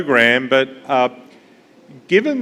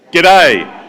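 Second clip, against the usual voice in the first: "G'day" called out loud and higher, Australian style; Aussie greeting in chorus; 3 semitones higher, 9 dB louder than usual. Shown as a demonstration. Room practises the Australian greeting on cue: loud shouted G'day. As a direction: shout "G'day" together on three. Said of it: "what I'd like you all to do is practice that".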